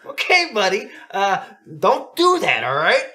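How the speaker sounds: high, strained fake-nice coach voice; mock-patient, loud and drawn out; carefully restrained coach. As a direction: hold back anger behind forced sweetness. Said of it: "I gotta be real careful what I say".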